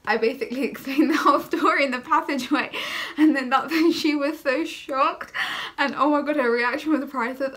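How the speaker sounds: real giggly excitement while telling; laughing excitedly. Real, not acted.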